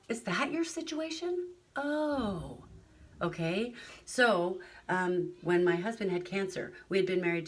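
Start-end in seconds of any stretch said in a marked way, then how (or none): 0.1-2.6 a gasp, then a high dawning surprise; gasping, a listener suddenly understands the other person's story; sudden realization. Pretend. Imagined realization after asking questions.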